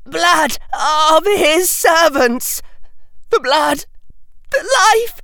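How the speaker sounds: high, anguished, halting shrieks; terrified seer wailing out a vision in bursts; crying out. A narrator's reading. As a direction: build to a long drawn-out scream. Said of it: "cried Harry Potter".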